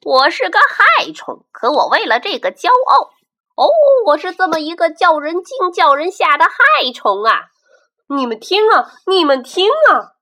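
loud, high boastful crowing; gleeful boasting yell; proud shout. Acted. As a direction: shout it out like a proud pest showing off. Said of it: "得意洋洋地喊起来".

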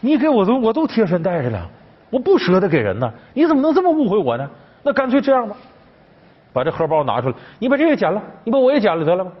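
protest, rising as the hurt grows; upset outburst. Retold or imitated. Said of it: "当时宝玉急了".

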